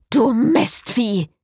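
high, drawn-out angry yell; angry cursing. A narrator's reading. Cécile insults her locked car, angry yell. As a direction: curse the stubborn machine.

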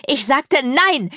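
slow, high, loud angry roar. A narrator's reading. Hero refusing the demon lord, roaring. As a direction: bellow the refusal word by word. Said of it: "brüllte ich".

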